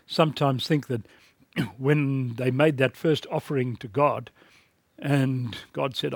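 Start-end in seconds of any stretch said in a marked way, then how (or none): none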